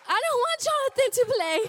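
high, loud, fast panicked blurt; panicked outburst. Retold or imitated. Frightened mom protesting her son playing, high panicked outburst.

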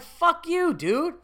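high, loud retold comeback; indignant retort. Re-enacts his indignant inner retort.

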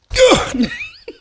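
sudden hacking cough from spicy fumes; harsh coughing fit. Real, not acted. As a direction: cough hard, choking on the smell. Face pushed into spicy bag.

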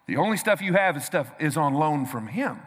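ordinary delivery

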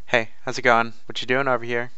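mock nonchalant greeting. A doctor strolling up to a colleague, mock nonchalant. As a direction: toss it off casually. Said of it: "very nonchalantly says".

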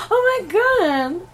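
high, slow, thrilled squeal; excited gasp. Retold or imitated. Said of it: "and I was like".

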